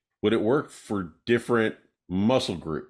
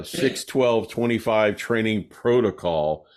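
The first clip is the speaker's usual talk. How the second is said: ordinary talk, nothing marked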